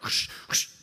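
voiceless swishing brush noises; two rushing mouth-made whooshes; imitated footsteps.

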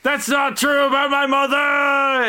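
high-pitched hammed-up shout; wounded protest. Game master voices insulted hippo fleeing, high wounded shout. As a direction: cry out in mock hurt while fleeing.

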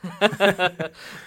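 sheepish laugh; embarrassed laugh. Real, not acted. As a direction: laugh at yourself. Laughs at eating crow.

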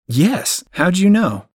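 high, eager surprise; startled how did she know; amazed. Acted. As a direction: pitch up, delighted she guessed. Amazed she guessed the shop.

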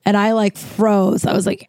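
ordinary delivery, nothing marked

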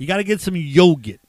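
put-on loud, insistent Boston accent. Barbecue guy insisting to questioning co-host, loud Boston accent. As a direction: push the point in broad Boston accent. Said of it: "use a Boston accent".

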